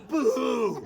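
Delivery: loud mock boo; crowd boo. The crowd boos the Canadian singer, loud. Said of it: "And he goes".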